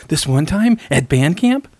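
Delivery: bright rising movie quote; quoting Allison Hannigan. Retold or imitated. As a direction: imitate the Allie line. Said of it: "find that clip of Allie saying that".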